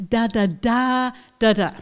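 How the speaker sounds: da da da beat; syllable rhythm. Shown as a demonstration. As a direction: count the syllables out as "da". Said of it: "five-syllable word with secondary stresses".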